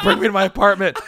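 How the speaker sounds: amused retelling of a desperate request; laughing through a plea. Retold or imitated. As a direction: giggle through the order to the cabbie. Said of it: "I'm like".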